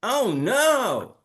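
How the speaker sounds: very high, lifted "Oh, no". Shown as a demonstration. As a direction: pitch it way up with excitement. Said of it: "Repeat after me".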